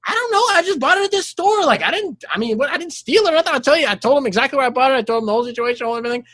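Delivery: high, fast pleading innocence; nervous defensive. Retold or imitated. Suspect explaining to detectives, high nervous pleading. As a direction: protest innocence in a rush.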